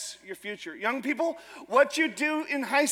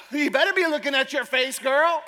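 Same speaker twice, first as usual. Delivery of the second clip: sassy mom; 2 semitones higher, 5 dB louder than usual. Retold or imitated. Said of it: "I'm like".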